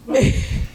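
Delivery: breathless hopeful gasp; startled gasp. Retold or imitated. Hearing the phone ring mid-shower, a breathless gasp.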